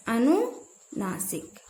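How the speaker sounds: अनुनासिक drawn out, split after अनु; slow, broken-up repetition. Shown as a demonstration. Teacher repeats the term अनुनासिक for students, slow and split. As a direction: say अनुनासिक very slowly in two parts, falling softly.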